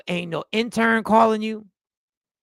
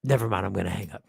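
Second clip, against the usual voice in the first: low, quick murmur; muted, hurried retreat in a low register; caller backing out; 12 semitones lower, 4 dB quieter, faster than usual. Acted. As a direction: drop deep and bail out hurriedly. Acted caller backing out of call.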